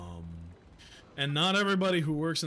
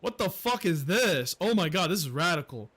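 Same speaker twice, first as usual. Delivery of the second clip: normal talking voice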